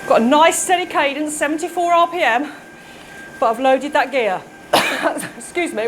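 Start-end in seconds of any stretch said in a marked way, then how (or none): 4.7-5.3 sharp real cough after swallowing water; a sudden cough, water down the wrong way. Real, not acted. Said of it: "water went down the wrong way".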